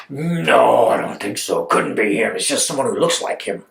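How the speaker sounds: low-voiced skeptical friend; dismissive doubt. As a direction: brush it off in a low pitch.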